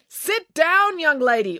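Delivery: high, stern command; ordering. A narrator's reading. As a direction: order a child sharply. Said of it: "ordered Mister Lang".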